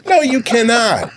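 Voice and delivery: high, slow, firm no; mock firm refusal.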